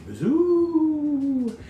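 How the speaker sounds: high swooping wind howl. A narrator's reading. Narrator for children, a high wind howl. Wind sound in ghost story.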